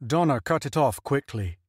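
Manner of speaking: plain reading voice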